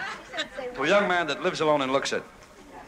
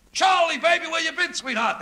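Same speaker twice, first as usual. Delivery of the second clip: high gushing greeting, much higher; gushing maitre d'; 4 dB louder than usual. Retold or imitated. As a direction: fawn over him like an old friend. Maitre d' greets Charlie effusively.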